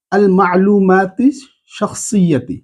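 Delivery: slow, rising আল মা'লুমাতি, falling শাখসিয়াতি; slow teaching read. Shown as a demonstration. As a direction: read it slowly for students to repeat. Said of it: "আমার সাথে সাথে এটা পড়ে নেন". (ধীরে পড়ে শেখানো)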